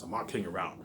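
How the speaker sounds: ordinary delivery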